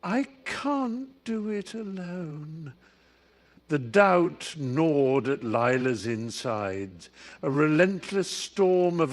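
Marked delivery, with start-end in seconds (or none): 0.0-2.7 high, fragile, pleading voice; trembling voice. A narrator's reading. Tearful Tilly confessing to Lila, voice trembling. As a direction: plead in a higher voice, close to tears. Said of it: "voice trembling".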